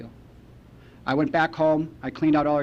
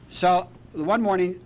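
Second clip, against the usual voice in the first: ordinary delivery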